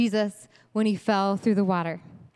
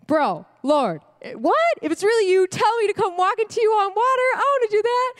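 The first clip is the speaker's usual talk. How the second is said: eager Peter; 8 semitones higher, 3 dB louder than usual. Acted. Peter voiced eagerly in retelling.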